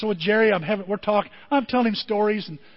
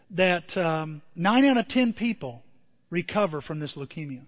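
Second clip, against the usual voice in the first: ordinary talk, nothing marked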